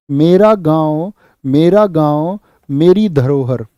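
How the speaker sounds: normal talking voice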